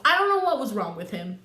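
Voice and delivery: ordinary delivery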